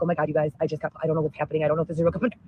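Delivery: low, soft, rushed frightened babble; mumbled panic, words tumbling out fast; frightened live stream babble, low and quick; scared rambling. Retold or imitated. Retelling her panicked live stream.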